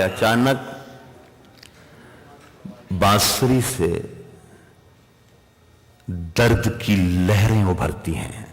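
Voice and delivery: low, hushed recital. Acted. Low soft recital of couplet.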